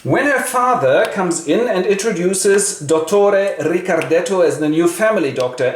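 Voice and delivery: ordinary delivery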